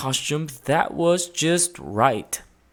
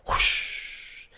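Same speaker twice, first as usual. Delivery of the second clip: whoosh; 5 dB quieter than usual. A narrator's reading. Sliding down the rail.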